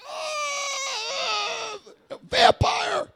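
high, loud hammed-up shriek of a vampire hit by sunlight; mock vampire shriek. A pilot hiding from the sunrise, mock vampire shriek. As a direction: cry out like a cartoon vampire in the sun.

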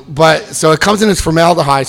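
normal talking voice

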